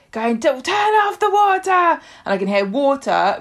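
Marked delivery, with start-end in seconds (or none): 0.4-1.7 high-pitched mock panicked yell; dad screaming. Podcaster retelling dad screaming at her about water, mock scream. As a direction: imitate dad's strained scream. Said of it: "my dad starts screaming at me".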